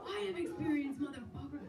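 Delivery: high, laughing mock outrage; hammed-up fury at the agent; wound-up retort. Comic imagines snapping at the phone agent, mock outrage. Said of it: "I'm like".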